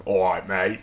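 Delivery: shaky attempt at a Cockney accent; bad Cockney. Shown as a demonstration. Practising a voice for D and D, bad Cockney. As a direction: try a Cockney hello, not quite landing it. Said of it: "I can't tell bad Cockney".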